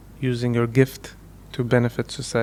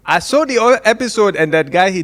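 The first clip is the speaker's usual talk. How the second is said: much higher, louder put-on voice of the noon lady; imitating guest; 7 semitones higher, 5 dB louder than usual. Host imitates future guest reacting to episode, high and loud. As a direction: squeal it out like an offended guest. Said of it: "she goes".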